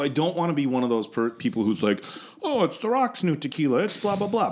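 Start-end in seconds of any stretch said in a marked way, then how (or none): 2.4-4.5 higher-pitched imitation of a hype merchant; dismissively sing-song mock enthusiasm; mock gushing. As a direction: parody an over-eager promoter, pitched up. Mocks gushing promoters.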